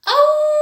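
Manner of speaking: aullido agudo y fuerte de lobo; aúlla alto como un lobo. Shown as a demonstration. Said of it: "¿Cómo hace el lobo?". (aullido de lobo muy agudo)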